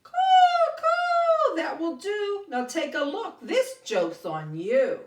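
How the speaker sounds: high, loud cawing crow voice; Tony Crow. Acted. Crow reveals the joke on Joey, high smug cawing. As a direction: caw smugly at the trick.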